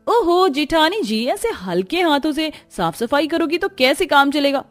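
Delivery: high, mocking bossy voice; haughty scolding. Rich sister-in-law scolding the worn-out elder one, haughty and high. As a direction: lord it over the poor sister-in-law.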